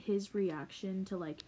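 ordinary talk, nothing marked

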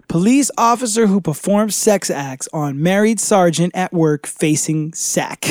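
ordinary talk, nothing marked

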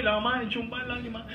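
ordinary talk

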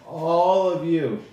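loud, high, drawn-out proclamation; grand slow address to the hall; stretched, projected stage speech; high elf orator. Acted.

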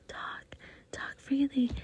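usual speaking voice

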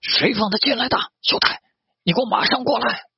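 high-pitched angry call; yelling. A narrator's reading. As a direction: holler for a subordinate to come at once. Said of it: "扯起嗓门大喊".

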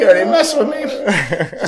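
usual speaking voice

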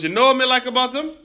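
high, quick Jamaican lilt on You know what I like about him; barber's thick Jamaican accent, imitated. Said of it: "a very thick Jamaican accent".

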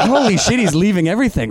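high-pitched, amused disbelief; mock astonishment. Friend watching the car being abandoned, amused and astonished. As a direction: marvel at it, half laughing.

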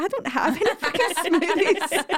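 half laugh it out, fed up; laughing exasperation. Retold or imitated. Exasperated mum, laughing while quoting.

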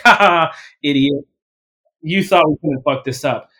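loud mock laugh, then taunting; hammed-up gloating taunt. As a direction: jeer like a gloating doubter. Imagined future interview mocking him.

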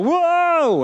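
high, drawn-out mock "whoa" whoop; imitated sudden yell. Churchgoers interrupting a sermon, imitated high whooping yell. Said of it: "all of a sudden yell".

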